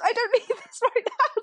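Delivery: high, giggly "I don't need this"; laughing exasperation. Retold or imitated. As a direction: laugh through the frustration.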